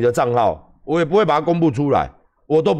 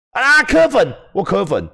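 high, loud shout; 8 semitones higher, 4 dB louder, slower than usual. Real, not acted. Yelling out to Ko Wen-je's fans on the stream. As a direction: call them out sharply.

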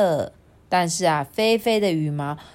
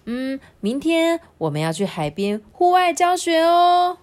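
higher-pitched, lightly voiced teacher announcement; teacher announcing; 8 semitones higher than usual. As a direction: announce it to the class with a lift. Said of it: "老师就宣布哦". (老师宣布的语气)

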